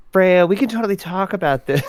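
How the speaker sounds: high-pitched pleading flashback voice; flashback character voice. Acted. Axiom staffer bargaining with Freya in flashback, high put-on voice.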